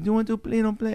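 put-on high, soft stab at an Israeli accent; bad Israeli accent. Kid asking neighbor to play, faltering Israeli accent. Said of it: "I can't do an Israeli accent".

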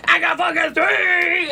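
high slow shouted retort; drunken comeback. Retold or imitated. Drunk mom yelling at the neighbor mother, slow high shout. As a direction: snap it back like a drunk jerk. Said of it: "because I'm an asshole".

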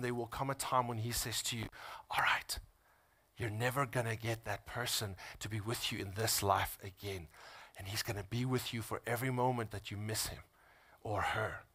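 low, soft, whispered comfort. Real, not acted. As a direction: hush it gently.

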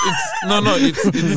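high laughing protest. Real, not acted. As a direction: laugh while denying. Caught out, laughing.

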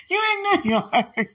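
high squeal through laughter; delighted laughing shriek. Real, not acted. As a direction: giggle it out in joy.

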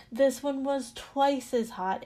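usual speaking voice

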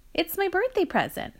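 high-pitched proud announcement. A narrator's reading. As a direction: show off your gift with pride. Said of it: "she said proudly".

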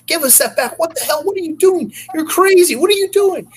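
high shrill-pitched yelling women; screaming strippers. Retold or imitated. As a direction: mimic the furious girls chasing him, way up high. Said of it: "They're like".

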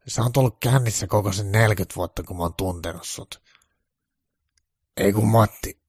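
ordinary delivery, nothing marked

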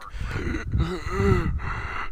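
imitated gasping for air; struggling to breathe. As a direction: act out high, choked gasps. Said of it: "struggling to breathe".

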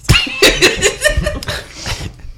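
high-pitched burst of giggles. Real, not acted.

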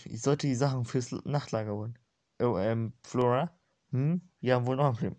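ordinary delivery, nothing marked

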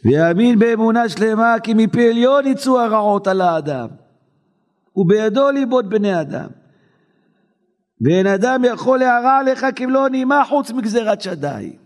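high, ringing text reading; preaching recitation. Real, not acted. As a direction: declaim the holy book. Reading holy text in chant.

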